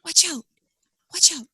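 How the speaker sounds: hushed mock warning; whispered warning. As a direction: whisper it teasingly.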